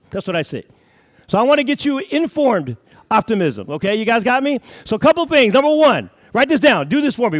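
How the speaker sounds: high, loud, fired-up motivational push; preaching at full force. Acted. A speaker firing up his seminar crowd, preaching loudly. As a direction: rouse the room like a revival preacher.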